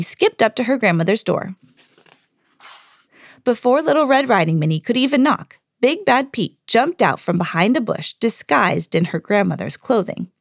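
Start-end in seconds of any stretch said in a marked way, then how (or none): none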